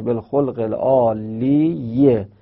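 slow, separated syllables in العالیه, ending "-ye"; ye pronounced. Shown as a demonstration. Teacher demonstrates the dropped vowel, final "-ye" voiced on العالیه. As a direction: draw out العالیه and sound its final kasra. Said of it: "این یه باید تلفظ بشه".

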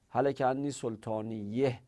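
سلطانیه ending in "-iyeh"; kasra ending. Shown as a demonstration. Teacher has students compare which is easier, kasra ending. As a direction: say سلطانیه with an e sound before the final h.

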